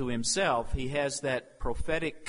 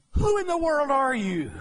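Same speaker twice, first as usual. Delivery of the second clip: questioning priests; 11 semitones higher, 6 dB louder than usual. Acted. Priests questioning John, acted.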